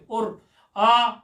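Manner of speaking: "आ" held very slowly and long; drawn-out aa. Shown as a demonstration. Teacher showing how Panini could have used आ. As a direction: stretch the "आ" out in one slow breath. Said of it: "ये भी तो कह सकते थे".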